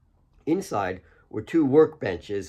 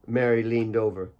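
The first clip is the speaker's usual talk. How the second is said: ordinary delivery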